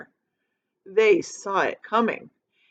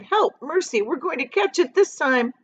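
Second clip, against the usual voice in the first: panicked cry; 7 semitones higher than usual. A narrator's reading. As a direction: beg for help in terror. Travelers panic under storm cloud.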